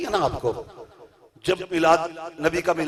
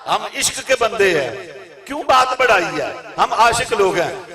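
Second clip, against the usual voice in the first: the couplet line again, louder and rising to a peak; louder repeat; 3 semitones higher, 8 dB louder than usual. Acted. Preacher repeating the verse to the crowd, louder. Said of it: "आप फरमाते हैं".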